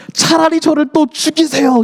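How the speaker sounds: high-pitched dramatic protest; hammed-up exasperated plea; mock angry outburst. Jonah again telling God to kill him, exasperated. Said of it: "하나님을 향해서 분노하기 시작합니다".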